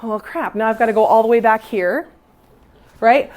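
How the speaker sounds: ordinary talk, nothing marked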